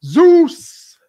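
high, loud exclamation; mock dramatic call. Calling out Zeus in a movie chat, loud mock cry.

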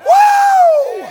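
high loud whoop; preacher whoop. Real, not acted. Preacher whooping at the congregation, high and loud. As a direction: let out an ecstatic woo.

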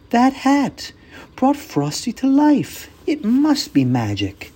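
put-on high, gasping child voice; amazed little girl. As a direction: marvel like a wide-eyed kid. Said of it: "Karen gasped and said".

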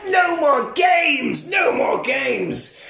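high, slow, loud hammed-up scream; mock scream. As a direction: scream it out, drawn out. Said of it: "And he's like".

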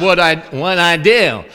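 put-on drawn-out Southern accent; Southern lady. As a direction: imitate a puzzled Southern woman. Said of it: "this one lady from Nashville".

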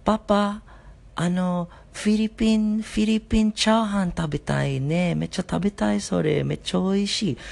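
put-on excited child voice, high; daughter's voice. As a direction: chirp like a small daughter asking for food. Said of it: "And she said".

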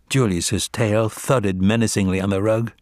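usual speaking voice

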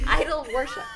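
flubbed Idol Worship; stumbling, laughing. Real, not acted. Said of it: "Why can't I say that?".

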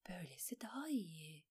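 whispered self-reassurance; hushed inner voice; talking to herself. A narrator's reading. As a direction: say it under the breath, only to herself. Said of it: "dedi Alain kendine".

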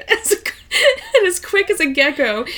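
ordinary delivery

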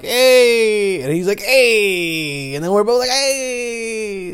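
high, drawn-out flirty hey; stretched high teasing hey; mock flirty hey. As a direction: coo it up high like a come-on. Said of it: "I'm like".

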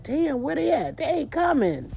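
a lone caller wondering where everyone is; mock disbelief. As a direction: stretch out they are not coming. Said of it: "you wonder, like".

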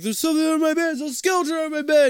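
put-on high, loud panicked kid; mock panic. Retelling boy's panic about skeleton.

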